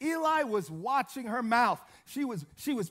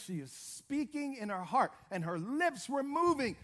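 usual speaking voice

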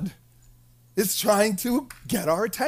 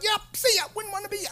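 high, flippant goodbye; mock breezy send-off; 12 semitones higher, 4 dB quieter, much faster than usual. Recovered patient leaving church behind, mock breezy send-off. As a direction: toss it off as you make your escape. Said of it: "As soon as they get out".

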